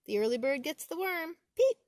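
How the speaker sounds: peeper shouting. Acted. The spring peeper calling out at the top of his lungs.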